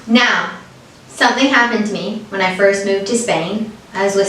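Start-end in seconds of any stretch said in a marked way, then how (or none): none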